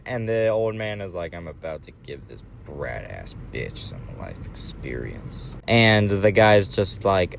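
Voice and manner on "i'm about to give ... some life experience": put-on much lower, much softer old-man growl; a low, quiet threat from the old man in the seat; grumpy old man.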